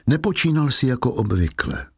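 ordinary delivery

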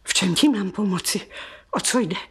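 ordinary talk, nothing marked

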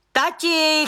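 insistent repeated call. A narrator's reading. Daughter calls father indoors.

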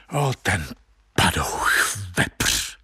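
low, under-the-breath cursing; low, halting grumble of abuse; muttered curse. A narrator's reading. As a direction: curse him bitterly, pitch dropped low. Narrator privately curses the deceiver.

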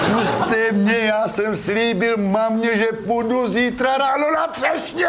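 high drawn-out mock wail, rising to a shout; retold desperate plea. Locked-up man begs to be let out, pleading wail.

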